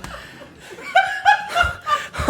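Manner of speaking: very high screaming laugh; shrieking laugh. Real, not acted. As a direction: shriek with laughter after a fright. Jump-scared, laughing hysterically.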